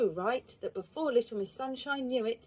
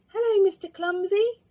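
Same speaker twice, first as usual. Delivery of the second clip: put-on high, loud cheerful little-girl voice; a sunny girl calling hello to a visitor; Little Miss Sunshine; 11 semitones higher, 9 dB louder than usual. A narrator's reading. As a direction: greet him brightly, way up high. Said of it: "said Little Miss Sunshine".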